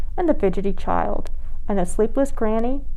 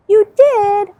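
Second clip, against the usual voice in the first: delighted surprise; 11 semitones higher, 7 dB louder than usual. Pretend. Host asks children.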